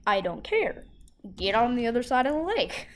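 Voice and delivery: loud retold order from an angry mom; stern mom. Mom ordering a rude kid across the lake, stern and loud.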